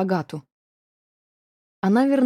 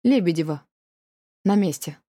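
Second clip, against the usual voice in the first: casual everyday tone. A narrator's reading. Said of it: "вполне будничным голосом".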